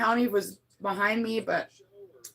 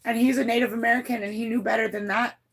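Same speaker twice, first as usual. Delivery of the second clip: normal talking voice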